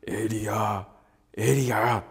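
low rumbling call of a name; deep voice of God calling Elijah; booming God voice. Shown as a demonstration. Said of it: "というような。".

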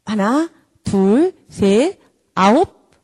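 mock exasperated parent stretching the count; counting threat. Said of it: "애청하거나 수를 세면 안 됩니다".

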